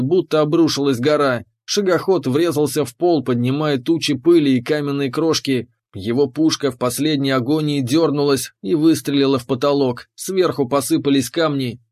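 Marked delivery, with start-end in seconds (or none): none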